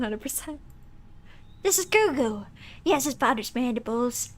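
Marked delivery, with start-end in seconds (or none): 1.6-4.3 lightly voiced proud monster mother; Lady Mance voice. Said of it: "doing her voice".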